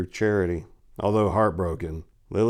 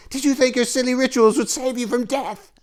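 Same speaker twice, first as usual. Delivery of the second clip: high mocking witch voice; gleeful witch. Burning witch mocking the paladins' rituals, high and gleeful. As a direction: taunt them like a cackling witch.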